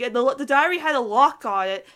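ordinary talk

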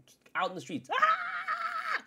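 short, high mock scream in the street; imitated street yell. Fan mimicking crazed New York crowd, mock yell. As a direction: let out a wild street yell.

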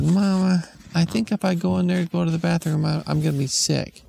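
put-on high, fast worried kid; a queasy little kid; child voice. Kid telling mom the bathroom is too smelly, high voice.

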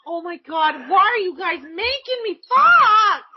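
high, loud retold whine; mock-outraged complaint rising to a shout; exasperated protest. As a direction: drag it out into an exasperated yell. Retells protest to managers.

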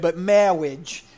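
slow, loud mock clergyman's drawn-out marriage; Princess Bride clergyman. Pastor mimics the movie's clergyman for the congregation, slow and loud. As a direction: stretch it out like the movie's wedding priest. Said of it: "the guy who can't enunciate his words clearly".

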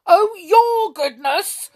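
high, loud, drawn-out roar; roaring temper. A narrator's reading. King throwing the words back, roaring. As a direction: roar it mockingly in a rage. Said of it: "suddenly roared the king in a temper".

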